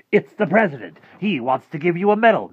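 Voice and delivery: high, loud, grand proclamation; excited announcement. Acted. Rictus on the phone tempting Harvey, excited. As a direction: announce a huge prize with soaring pitch.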